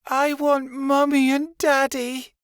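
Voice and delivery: put-on high, slow child's wail; a small child begging through tears; crying child. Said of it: "Keanu began to cry".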